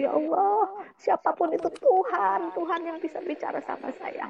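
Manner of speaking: high cry fading away at the end; retold desperate cry. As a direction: call out to any god. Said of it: "Saya bilang gitu".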